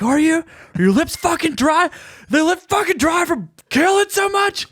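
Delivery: high, strained angry outburst; so mad. Retold or imitated. Comedian mad at the winner on the car ride home, high-pitched mock fury. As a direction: squeal the sarcastic questions slowly, at the top of your range. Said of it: "so mad".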